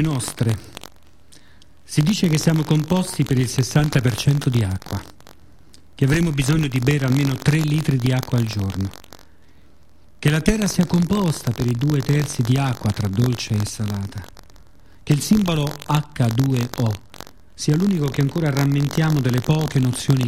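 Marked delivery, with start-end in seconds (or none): none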